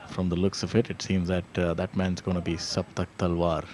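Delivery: live golf play-by-play, conversational; golf commentator. Real, not acted. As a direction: call the putt like a TV golf commentator.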